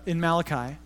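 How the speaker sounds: usual speaking voice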